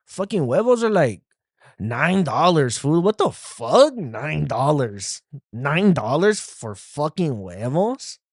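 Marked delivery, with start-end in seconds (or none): 3.0-3.9 outraged. Real, not acted. Outrage at nine-dollar eggs. 3.9-8.2 incredulous. Real, not acted. Outrage at nine-dollar eggs.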